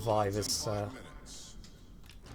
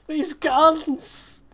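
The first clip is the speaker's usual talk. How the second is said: exasperated exclaim; 14 semitones higher, 5 dB louder than usual. Real, not acted. Complains about guns.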